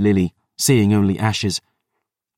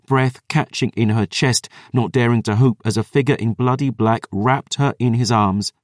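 ordinary delivery

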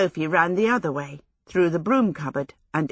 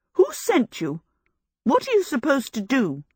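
put-on higher, eager young woman asking; Sophie questioning; 7 semitones higher than usual. A narrator's reading. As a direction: press the Scarecrow for answers. Sophie voiced in story.

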